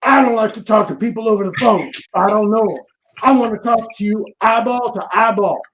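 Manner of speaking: hint of a gruff sergeant laying down the law; stern stepdad over the phone; booming drill sergeant. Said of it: "I hear this booming voice".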